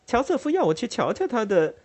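a sweet daughter playing innocent; sister's voice. Amelia answers her father.

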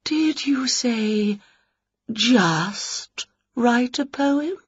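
very slow, soft trembling spook; a delicate poetic ghost, trembling; quivering ghost. Acted. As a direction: float each word out slowly. Ghost affronted.

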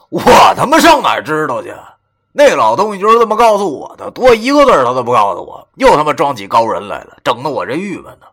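loud, high exasperated complaint; wry exasperation. A narrator's reading. Venting to a friend about the cryptic old man, wry exasperation. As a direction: protest with a rueful edge. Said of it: "我苦笑着说".

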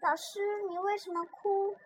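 put-on high, soft child's question; small soft voice. A narrator's reading. Little Apple asking the crying teacher, small soft voice. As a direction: ask timidly, lifted in pitch and hushed. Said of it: "小声地问".

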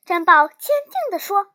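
usual speaking voice

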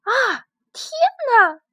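high, loud startled scream. Acted. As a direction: shriek in sudden fright. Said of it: "斯坦利也尖叫起来".

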